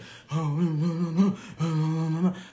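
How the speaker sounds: wordless muttering, round and round; mock crazed repetitive mumbling; imitated repetitive babble. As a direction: mimic someone babbling to themselves on a loop. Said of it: "same thing over and over".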